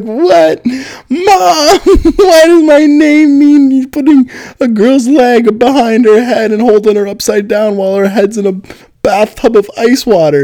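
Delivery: very high, loud, hammed-up tearful whine; mock wailing kid. Kid asking mom about his name, hammed-up wail.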